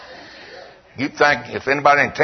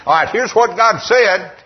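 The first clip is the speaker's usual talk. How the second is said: mock preacher proclamation; 7 semitones higher than usual. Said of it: "You can't just get up and say".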